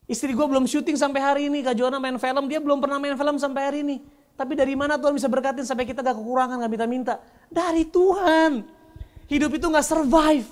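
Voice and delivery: high, loud, impassioned preacher; full-force preaching. Real, not acted.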